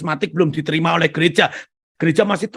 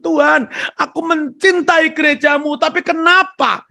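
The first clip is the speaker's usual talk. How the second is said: high, loud desperate plea to God; a broken man shouting his grief at God; anguished crying out; 10 semitones higher, 4 dB louder than usual. Retold or imitated. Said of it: "dia menangis sejadi-jadinya".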